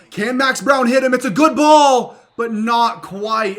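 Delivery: hopeful shout that deflates; excited call. Real, not acted. Deep throw to open receiver.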